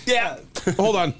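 high, slow laughing cop; giggling cop holding it together; mock cop. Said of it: "cops are like".

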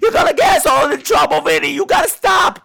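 put-on high, loud Brooklyn Italian accent yelling; hammed-up Brooklyn Italian shout; screaming Brooklyn Italian. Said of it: "sat up screaming in an Italian accent, like a Brooklyn Italian accent".

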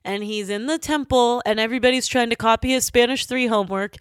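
usual speaking voice

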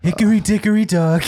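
high, hammed-up sing-song rhyme in a mock Trump voice; Trump reading nursery rhymes. Comic plays Trump reading nursery rhymes for the co-host, mock sing-song. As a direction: recite it like a pompous Trump doing kids' verse. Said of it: "I want Trump to, like, read nursery rhymes".

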